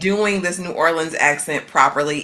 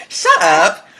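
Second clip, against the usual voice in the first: emphatic rebuttal; 7 semitones higher than usual. Real, not acted. Co-host disputing the New Orleans vibe, emphatic. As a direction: shoot the claim down firmly.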